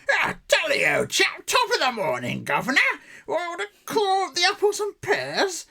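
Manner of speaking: loud, high mock London copper; caricature London policeman. Policeman from episode one hailing a chap, hammed-up London shout. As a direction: bellow like a cartoon British bobby. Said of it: "Versus the policeman in episode one".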